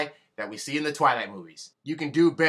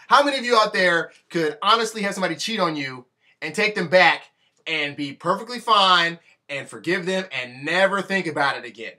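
usual speaking voice

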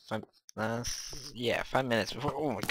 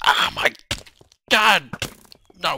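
loud cry leaping higher; startled shout; 9 semitones higher, 10 dB louder than usual. Real, not acted. Gamer caught by sudden danger, startled shout.

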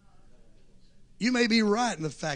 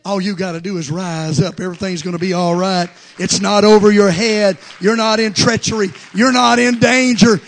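loud, rising preaching; preaching at full force; 4 semitones higher, 16 dB louder than usual. Acted. Preacher reassuring the congregation, loud and rising. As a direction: build it to a roar.

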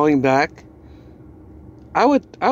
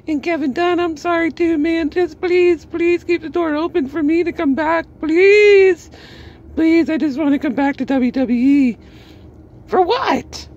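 very high, louder whiny pleading; mock begging; 3 dB louder than usual. Vlogger mocking Mickie begging WWE, very high whine. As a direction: beg to come back like a desperate crybaby. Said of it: "you're gonna be like".

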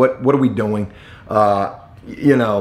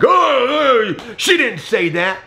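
high-pitched imitation of a yelling supervisor; mock yell; 11 semitones higher, much slower than usual. Supervisor cutting the neighbor off to defend his colleague, yelling. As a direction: burst out high, then stretch the denial. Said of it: "he yells at me for a hot second".

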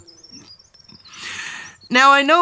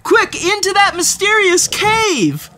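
frantic shout. Acted. Narrator steering Poopsie through the chase, frantic shouting. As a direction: shout directions in a panic.

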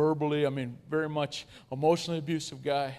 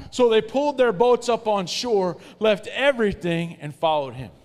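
higher, louder, slow recital of the verse; louder read-aloud; 5 semitones higher, 8 dB louder than usual. Shown as a demonstration. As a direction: push it out stronger for the crowd. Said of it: "One more time".

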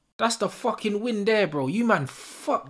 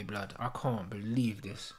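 ordinary delivery

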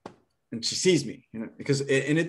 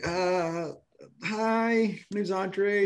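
nervous greeting; 9 semitones higher, much slower than usual. Retold or imitated. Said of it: "I'm like".

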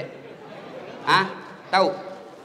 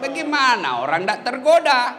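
usual speaking voice

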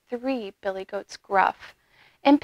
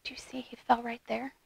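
quick hushed whisper; whispered aside; 13 dB quieter, faster than usual. Real, not acted. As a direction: whisper it confidingly to the listeners. Hushed aside to the young listeners.

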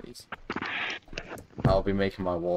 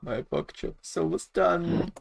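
high slow squeaky voice; silly high voice; 10 semitones higher than usual. Acted.

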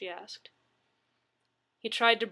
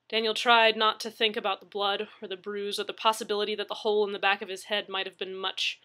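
ordinary delivery

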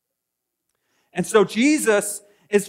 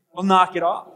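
ordinary talk, nothing marked

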